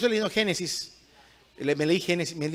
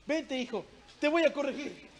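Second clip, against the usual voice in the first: high, soft voice of a lenient parent; mock feeble father; 7 semitones higher, 4 dB quieter than usual. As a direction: play at threatening correction, far too gently. Mimics a soft, ineffectual father.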